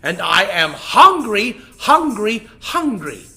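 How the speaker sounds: big loud demanding lion voice; a greedy king of beasts scolding the rabbit; lion king, hungry and boastful. Acted.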